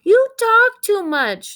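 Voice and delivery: high, loud, slow yell; yelling father. Acted. Father yelling at the chatty dog, loud and slow. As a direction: bellow it out, fed up. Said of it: "yelled Father".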